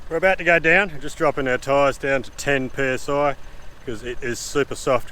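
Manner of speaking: ordinary delivery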